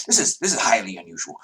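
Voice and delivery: quick, huffy objection; huffing guard. Acted. Guards objecting to unexpected emissaries, huffing quickly. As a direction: huff it out fast, tripping over the start. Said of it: "the guards are huffing".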